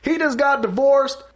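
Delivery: personal gossip shouted as a play call; high, loud quarterback bellow in separate bursts; Peyton Manning. Retold or imitated. Quarterback exposing an opponent on the field, mock shouted call. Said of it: "he really was like".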